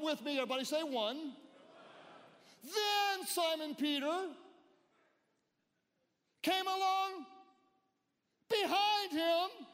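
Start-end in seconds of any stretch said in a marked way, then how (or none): 2.6-9.7 loud, high and slow, each phrase held; slow shouted climax. Acted. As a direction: stretch it out like a slow-motion race. Dramatic race climax.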